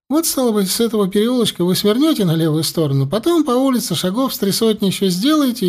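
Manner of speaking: высокий угодливый говорок; услужливо растолковывает дорогу; заискивающий мужичок. A narrator's reading.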